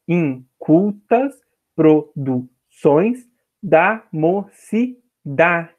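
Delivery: very slow, each syllable set apart; counting poetic syllables. Shown as a demonstration. Teacher scanning the line for students, slow separated syllables. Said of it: "a gente vai contar".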